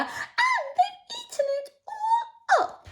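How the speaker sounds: very high, tiny, tearful squeak; squeaky Baby Bear. Acted. Storyteller voicing Baby Bear, a high tearful squeak. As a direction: squeak it out like a sad little cub.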